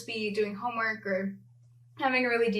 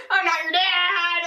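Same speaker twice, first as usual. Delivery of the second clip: laughing protest; 8 semitones higher, 6 dB louder than usual. Real, not acted. Mock protest through laughter.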